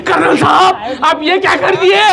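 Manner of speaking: high-pitched mock outcry to the husband; colonel's shocked wife. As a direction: cry out like an appalled wife. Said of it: "पीछे से बेगम देख लिए".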